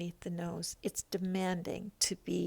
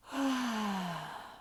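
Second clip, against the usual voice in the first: deep sigh. Shown as a demonstration. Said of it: "take a deep breath".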